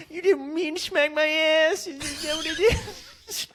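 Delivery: very high, mocking voice, shouting at the end; mocking stripper. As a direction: tease him in a high fake-sweet stripper voice. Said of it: "They're like".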